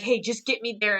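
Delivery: ordinary talk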